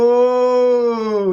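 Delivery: long, loud, drawn-out "whoooa"; mocking group "whoa". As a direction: jeer at someone's embarrassment, holding the note. Imitates onlookers jeering his embarrassment.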